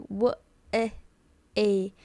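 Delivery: WAY broken into w-, eh, a; separated sounds with gaps between; syllable by syllable. Shown as a demonstration. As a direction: sound out w, eh, a one piece at a time. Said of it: "separate every syllable".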